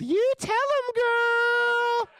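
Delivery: very high, loud whoop out the window; yelled cheer. Retold or imitated. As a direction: holler it shrieking high, like egging someone on. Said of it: "cracked open the window and yelled".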